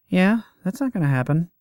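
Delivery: husky woman, quick and high; voz de mulher rouca. Acted. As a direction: toss it off like a tough woman. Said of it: "A husky woman's voice".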